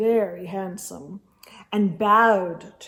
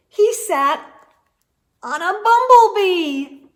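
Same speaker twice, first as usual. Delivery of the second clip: high, loud build to bumblebee; storyteller's big moment; dramatic reveal; 8 semitones higher, 7 dB louder than usual. A narrator's reading. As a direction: land the bumblebee with a big rise. Climax of story read aloud.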